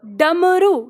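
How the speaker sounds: डमरू said clearly, ड with the tongue curled back; careful डमरू. Shown as a demonstration. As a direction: model the word डमरू for students. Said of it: "तो उच्चारण करो". (डमरू का साफ़ उच्चारण)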